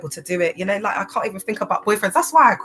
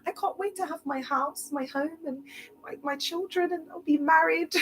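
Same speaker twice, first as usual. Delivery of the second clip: put-on much higher, softer dreamy girl voice; imitating little daughter; 9 semitones higher, 6 dB quieter than usual. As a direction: breathe it like a starry-eyed little girl. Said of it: "She said".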